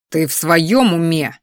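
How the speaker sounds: ordinary delivery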